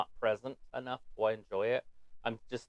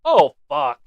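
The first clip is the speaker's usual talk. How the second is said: loud startled curse; shocked; 3 semitones higher, 16 dB louder than usual. Real, not acted. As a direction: yelp at the crash. Reacting to a bad landing.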